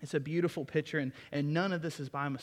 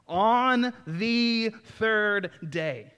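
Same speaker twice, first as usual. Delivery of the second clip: every word drawn out slow and high, louder and ringing; dramatic emphasis; 7 semitones higher, 9 dB louder, much slower than usual. Acted. Preacher to congregation, savouring the phrase in a slow, high, loud proclamation. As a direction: savour each word like a revelation.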